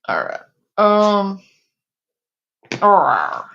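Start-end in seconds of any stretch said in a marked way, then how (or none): 2.7-3.5 mock growl.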